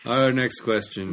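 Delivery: slow announcer delivery of the intro; old radio voice. Shown as a demonstration. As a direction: draw it out like a vintage radio host. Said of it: "that's my sort of warm-up voice".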